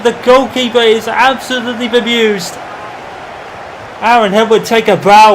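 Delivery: shouting, high-pitched play-by-play; goal-call commentary, loud and high; excited goal call. Acted. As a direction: roar the goal like it's the strike of the season.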